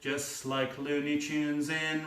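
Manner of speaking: slow, quiet, drifting thought; slow musing. Acted. Filmmaker solving the circle, slow and musing. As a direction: let it trail dreamily.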